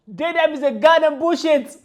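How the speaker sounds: loud, slow, each word hammered; angry rant. Real, not acted. Angry vlogger denounces pronouns to viewers, loud. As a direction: spit it out, furious. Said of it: "really pissing me off right now".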